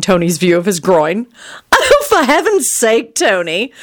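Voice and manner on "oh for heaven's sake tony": high, slow, amused exclamation; chortling. A narrator's reading. Wife amused at friend's sudden arrival, chortling. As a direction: chortle at the silly sight. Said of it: "she chortled".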